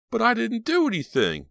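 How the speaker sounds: high, loud frightened plea; fearful. A narrator's reading. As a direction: plead in panic. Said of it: "Rob repeated fearfully".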